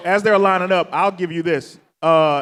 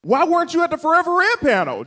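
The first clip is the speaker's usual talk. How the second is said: eager higher-pitched question to a fellow actor; retelling his own overeager greeting, pitched up; excited; 11 semitones higher than usual. Retold or imitated. Retelling how he greeted Steve after the panel, eager and high. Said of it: "Just, like, excited".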